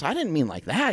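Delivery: mock coach voice. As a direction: brush him off like the coach. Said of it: "he goes".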